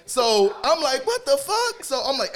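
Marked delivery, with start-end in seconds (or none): 1.1-1.8 retold high yelp of surprise; mock shocked. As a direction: squeak it out like you can't believe it. Retelling his own shocked reaction.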